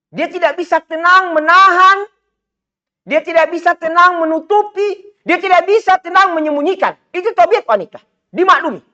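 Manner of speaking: rising preacher's fervour; fiery preaching. Acted. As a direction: preach it out high and hard to the crowd. Preaching at full force.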